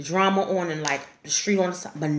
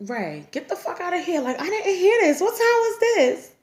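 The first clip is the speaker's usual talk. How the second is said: higher, slowing down in disbelief; can't believe what she's hearing; incredulous; 11 semitones higher than usual. Retold or imitated. Incredulous reply to Ray.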